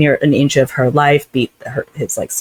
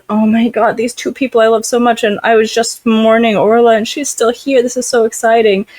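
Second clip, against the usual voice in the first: higher, lightly gushing retelling; mock thrilled heroine; 6 semitones higher than usual. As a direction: gush it a little higher, imitating her delight. Voices heroine thrilled by reunion.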